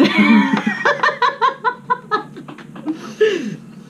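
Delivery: long high giggle. Real, not acted. Joking about bite count.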